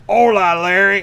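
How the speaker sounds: put-on loud, high Mexican-accented greeting; hammed-up Mexican holler; mock Mexican. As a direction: call out as a caricatured Mexican friend. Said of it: "I got a friend who's, like, from Mexico".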